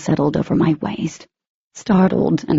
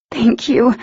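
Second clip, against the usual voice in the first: choked-up thanks, pitched a little higher; voice thick with emotion; 6 semitones higher than usual. A narrator's reading. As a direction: thank him through a tight, teary throat. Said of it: "voice thick with emotion".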